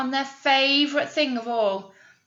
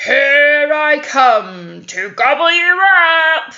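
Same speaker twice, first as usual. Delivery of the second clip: slow, much louder and higher booming bellow; bellowing troll; 5 semitones higher, 11 dB louder, slower than usual. Acted. Troll jumping up at the goat, bellowing slowly. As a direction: roar it out to gobble them up.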